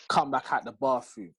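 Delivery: ordinary talk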